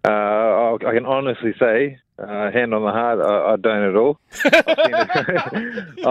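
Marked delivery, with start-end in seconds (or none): 4.4-6.1 laughing through the words; chuckling while talking. Real, not acted. Laughing at the memory.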